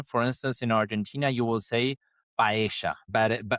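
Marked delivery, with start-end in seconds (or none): 2.4-3.0 "paella" with the double L said as "sh", pa-E-sha; Argentine pronunciation. Shown as a demonstration. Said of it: "with this different pronunciation".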